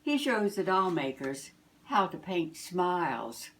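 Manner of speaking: usual speaking voice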